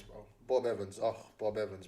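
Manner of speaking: normal talking voice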